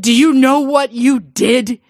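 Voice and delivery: loud, slow, high accusation; scolding father. Acted. Father scolding his clever daughter, loud and slow. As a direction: accuse her, drawn out and loud.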